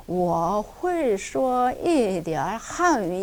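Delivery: 我会说一点汉语 slow, clear tones; 我会说一点汉语 each tone exact; 천천히 정확한 성조; careful tones. Shown as a demonstration. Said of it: "이렇게 꼭 하셔요".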